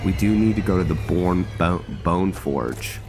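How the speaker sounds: ordinary delivery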